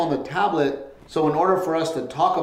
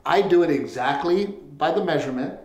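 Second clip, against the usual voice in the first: ordinary talk, nothing marked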